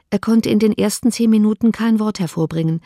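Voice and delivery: plain reading voice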